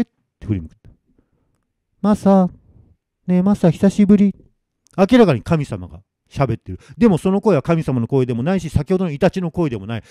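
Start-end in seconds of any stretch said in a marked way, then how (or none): 2.0-4.4 high, sweet, slightly nasal greeting; sugary ghost voice. Acted. Dead Noriko greeting Masa after long absence, sugary. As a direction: coo it like a long-lost girl friend. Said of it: "甘ったるいような、".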